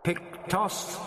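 hushed, rising alien query; startled question. A narrator's reading. The voice in the dome, suddenly unsure, a quiet startled question. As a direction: caught off guard.

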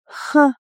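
sharp loud grunt of a laugh; gruff grunting ha. A narrator's reading. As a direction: bark out a short ha. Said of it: "крякнул Йонсон".

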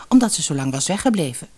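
ordinary delivery

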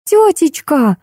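put-on high girlish call, mildly shouted; yelling. A narrator's reading. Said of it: "заорала Машенька". (звонко окликает, слегка крича)

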